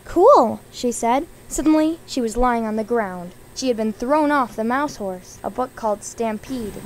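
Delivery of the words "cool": high, loud exclamation; excited cool. A narrator's reading. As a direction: burst out with it. Excited answer to ride offer.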